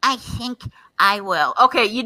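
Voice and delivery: mock man voice; put-on manly voice, held back a little; imitating a man. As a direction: answer in the hammed-up man voice. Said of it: "You should do that from now on".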